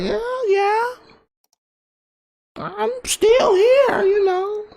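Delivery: high frail grandma voice. Retold or imitated. Imitating his grandmother, high and slow.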